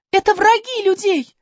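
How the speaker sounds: put-on high girl voice, urgent. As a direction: declare it urgently. Alice answers dolphin. (взволнованно)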